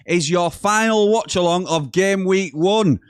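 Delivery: high, slow, stretched-out announcer opening; big drawn-out showman welcome; hyped-up intro. Acted.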